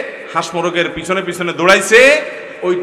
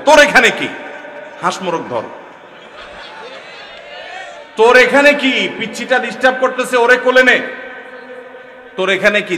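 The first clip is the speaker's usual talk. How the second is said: scolding shout; 5 semitones higher than usual. Retold or imitated. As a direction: bark at the kid to get out of the way. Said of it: "দাদা এক ধমক দেয়".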